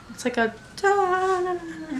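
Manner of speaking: high hummed "da na na" imitating a stringed instrument; humming the instrument. Recapping Miriam's instrument, imitates its sound lightly. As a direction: sing the instrument's notes softly, lightly imitated. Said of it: "like that kind of instrument".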